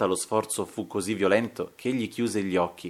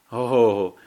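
loud exclamation through a grim smile; almost gloomy smile; 6 dB louder than usual. A narrator's reading. Duellist exclaims to his friend, almost gloomy smile. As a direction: exclaim it with an indefinable, near-funereal smile. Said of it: "esclamò con un sorriso indefinibile che era quasi lugubre".

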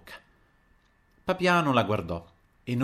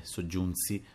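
ordinary delivery, nothing marked

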